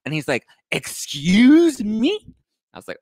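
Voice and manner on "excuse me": very high, loud, stretched-out offence; hammed-up drawn-out indignation; mock outraged. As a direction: act out a scandalised diva. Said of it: "you can tone it down".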